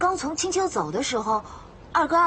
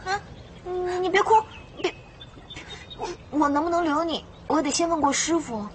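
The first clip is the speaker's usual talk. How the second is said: higher, stammering panic; hurriedly calm someone about to cry; flustered; 5 semitones higher than usual. Acted. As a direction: caught off guard, halting and high. Flustered by visitor starting to cry.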